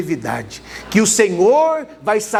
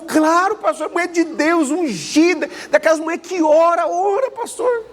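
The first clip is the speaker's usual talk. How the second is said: much higher, eager insistence; woman insisting the prophetess is anointed; voicing a believing woman; 8 semitones higher than usual. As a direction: gush about how holy she is.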